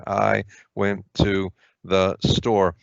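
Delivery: "I went to the store" read at a slow, careful pace; slow demo reading. Shown as a demonstration. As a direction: spell out "I went to the store" word by word, slowly. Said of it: "no punctuation separating them".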